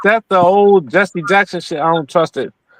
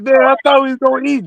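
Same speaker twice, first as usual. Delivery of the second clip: higher and fast, a complaining monkey; a cheated monkey protesting; mock monkey voice; 6 semitones higher, faster than usual. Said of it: "The monkey be like".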